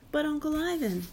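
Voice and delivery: put-on high, childlike plea; pleading. A narrator's reading. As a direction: plead like a child begging an adult. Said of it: "Ruby pleads".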